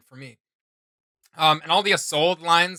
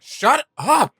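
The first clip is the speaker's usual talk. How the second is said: high, loud snapped "shut up"; shouting; 8 semitones higher, 6 dB louder than usual. Retold or imitated. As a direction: yell it at the villain. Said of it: "she's like".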